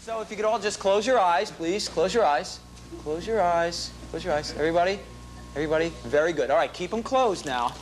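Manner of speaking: calm soothing instructions; guided instruction. Acted. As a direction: guide them gently. Leads audience eyes closed.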